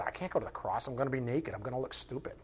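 soft, low, sheepish excuse; hushed mock whine of self-consciousness; mock reluctance. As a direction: back out meekly, under your voice. Imagined Christ balking at the cross.

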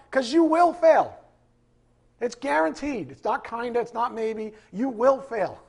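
loud, high insistence on failure; preaching at full force. Acted. Pastor warns the congregation, loud and high. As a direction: shout the warning down at them.

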